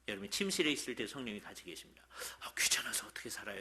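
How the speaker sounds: fast, whispered aside; quick whisper. Real, not acted. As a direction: hurry it under your breath like a secret. Intimate aside about bedroom, complaint. (빠르게 속삭이며)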